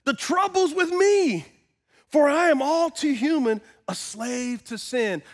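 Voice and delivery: high, emphatic start easing down; impassioned scripture reading. Acted. Preacher reads Paul confessing he is a slave to sin, impassioned. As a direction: read it as a personal confession.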